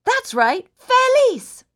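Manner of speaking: very high, loud happy cry; happy shout. Shown as a demonstration. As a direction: belt it out with joy. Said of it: "smile really big".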